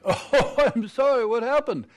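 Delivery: laughing apology. Acted. Misheard NASA as Bahamas, laughing.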